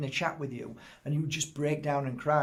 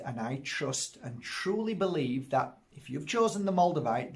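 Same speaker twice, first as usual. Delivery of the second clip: ordinary talk, nothing marked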